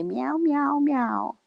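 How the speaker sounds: high, playful meowing; cat meowing. Retold or imitated. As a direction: meow like a scared kitty. Imitating cat Ginger's meows.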